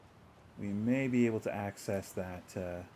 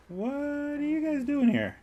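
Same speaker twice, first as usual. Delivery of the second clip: surprised; 4 dB louder than usual. Real, not acted. NPCs show up unexpectedly.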